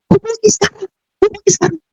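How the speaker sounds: high, urging inner voice; a voice in her heart pressing her. Retold or imitated. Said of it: "di dalam hati kecil ini". (suara hati yang mendesak, nada tinggi)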